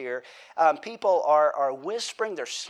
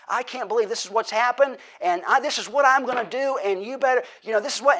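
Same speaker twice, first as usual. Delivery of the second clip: agitated king; 8 semitones higher, 6 dB louder than usual. Retold or imitated. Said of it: "imagine David coming in ... saying".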